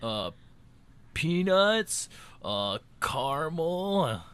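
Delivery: high, halting list, ending on slow two-syllable "caramel"; pretend quiz answer. Acting out being tricked into saying caramel, pondering. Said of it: "you would have to, like, trick me".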